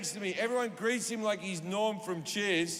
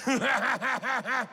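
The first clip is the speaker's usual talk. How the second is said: laughing; 3 semitones higher than usual. Retold or imitated. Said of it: "he just went".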